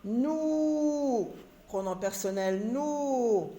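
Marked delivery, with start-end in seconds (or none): 0.0-1.3 "Nous" held long and high, then falling; sustained, higher-pitched teaching "Nous"; elongated vowel. Shown as a demonstration. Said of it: "on allonge un petit peu le son". 2.7-3.5 "nous" with a long "ou", falling at the end; drawn-out teaching "nous"; elongated vowel. Shown as a demonstration. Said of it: "on allonge un petit peu le son".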